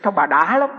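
ordinary delivery

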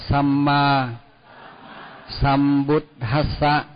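each word set apart, slow and clear; slow careful Pali recitation. Shown as a demonstration. Teacher models slow Pali words for students. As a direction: spell out Nam mô Tassa word by word. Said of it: "Bây giờ đọc lại".

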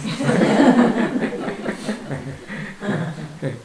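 long, high laughing fit; real amused laughter; hearty laugh. Real, not acted. Laughing after own joke.

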